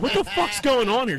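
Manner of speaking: high, loud, slow disbelieving shout; mock outraged yell. Son yelling at mom over coworker's sauce, outraged high shout.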